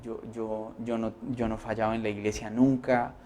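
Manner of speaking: soft, low, hurt complaint to God; complaining prayer. Acted. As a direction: murmur the grievance quietly. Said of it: "Porque usted podría decir". (queja suave y dolida a Dios)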